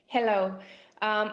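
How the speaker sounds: ordinary talk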